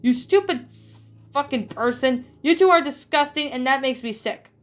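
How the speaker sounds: high, loud insults hurled; furious boy. Acted. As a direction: explode at mother and sister. Danny's furious outburst at family.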